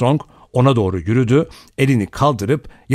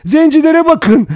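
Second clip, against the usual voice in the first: high, loud scream of alarm; shrieked cry; 9 dB louder than usual. A narrator's reading. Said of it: "diye haykırdı". (tiz sesle haykırarak)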